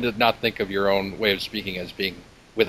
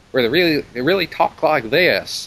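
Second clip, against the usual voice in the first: Southern accent; 6 semitones higher than usual. Shown as a demonstration. Guest demonstrates the South for the visitor, Southern accent. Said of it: "the Southern accent".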